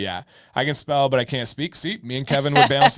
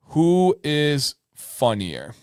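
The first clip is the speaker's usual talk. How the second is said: slow, deliberate reading of who is funnier; slow emphasis; 2 semitones higher, much slower than usual. Shown as a demonstration. Host rereading the prompt slowly for the guest. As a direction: spell out the question slowly. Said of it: "different interpretations of these".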